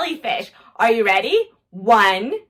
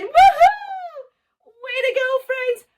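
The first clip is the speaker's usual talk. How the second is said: excited woohoo; 10 semitones higher, slower than usual. Real, not acted. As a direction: celebrate the finish. Finished counting to ten.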